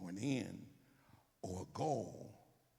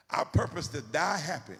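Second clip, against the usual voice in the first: emphatic declaration; 5 semitones higher, 9 dB louder than usual. Acted. Preacher declaring.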